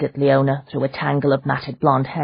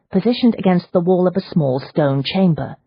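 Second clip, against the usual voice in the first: usual speaking voice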